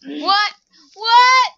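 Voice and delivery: loud high "what", building to a yell; shouted disbelief. Real, not acted. Player stunned by the game, escalating incredulous shout.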